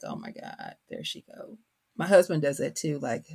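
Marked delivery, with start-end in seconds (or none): 0.0-1.6 mock whisper, much softer, barely voiced; whispered exasperation. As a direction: breathe it like an embarrassed kid under your breath. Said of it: "my kids are like".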